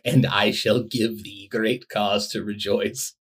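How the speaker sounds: slow, stately olde English voice; Middle English affectation. Shown as a demonstration. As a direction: speak like a dog from a medieval tale. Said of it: "Middle English affectation where they say things like".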